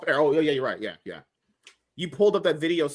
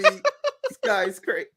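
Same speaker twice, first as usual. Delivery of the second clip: laughing; 12 semitones higher, much slower than usual. Real, not acted. Laughing disbelief at friend.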